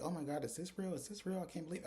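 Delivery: excited disbelief. Retold or imitated. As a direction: imitate someone who can't believe her luck. Excited disbelief at new house.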